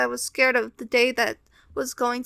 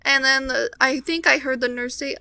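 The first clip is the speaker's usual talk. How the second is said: normal talking voice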